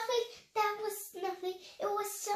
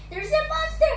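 sobbing; 9 semitones higher, 8 dB louder than usual. Acted. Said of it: "he sobbed".

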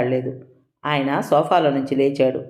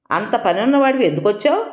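sarcastic; 6 semitones higher than usual. A narrator's reading. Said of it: "ఆ మాటలో వ్యంగ్యం ఉంది".

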